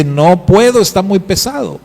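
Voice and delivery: voz de niño que no puede, un poco más aguda; niño agobiado. Acted. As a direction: como un niño cansado que se rinde. Niño responde al padre.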